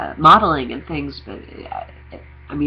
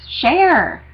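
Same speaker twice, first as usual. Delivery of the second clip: emphatic high call; 12 semitones higher, 5 dB louder than usual. Real, not acted. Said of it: "urging people to share".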